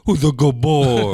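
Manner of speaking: high, booming, stretched-out praise; dog-talk voice. Shown as a demonstration. Owner as the tail starts wagging, loud dog-talk voice. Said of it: "my voice is like".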